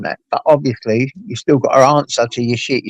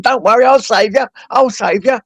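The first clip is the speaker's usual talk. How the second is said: high, urgent "I'll save you" as if rescuing; playing the hero cutting spiders out; frantic reassurance; 10 semitones higher than usual.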